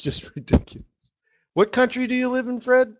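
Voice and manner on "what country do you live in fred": mock question. Real, not acted. Mock asking viewer.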